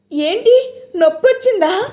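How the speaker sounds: high, worried question; anxious concern. A narrator's reading. Cook asking about pain, worried high voice.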